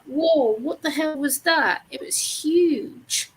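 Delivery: retold amazement. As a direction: re-enact being startled by something. Retells shock at seeing a mole.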